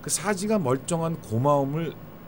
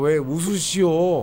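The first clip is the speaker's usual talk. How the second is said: Honam dialect; 2 semitones higher, slower than usual. Shown as a demonstration. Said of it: "그런 사투리가 있고".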